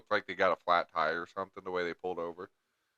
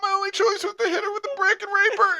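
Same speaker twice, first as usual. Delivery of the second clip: very high, loud squeal through laughter; laughing mock plea; 11 dB louder than usual. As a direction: force out a fake alibi while cracking up. Joking mock alibi amid laughter.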